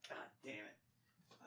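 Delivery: whispered, slow frustrated curse; voiceless groaned curse; frustrated under breath. Real, not acted. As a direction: mutter it to yourself in defeat. Can't remember movie name.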